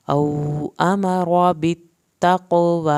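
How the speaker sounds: very slow chant, long vowels; slow recitation. Shown as a demonstration. Teacher to students: very slow tajweed chant. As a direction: intone the phrase, stretching every long vowel. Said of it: "وا کو کھینچ کے".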